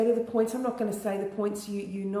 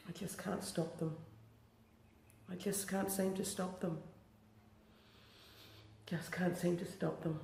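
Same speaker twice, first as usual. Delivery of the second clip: soft tearful tapping phrases; 9 dB quieter than usual. Acted. Tapping script, tearful phrases softly.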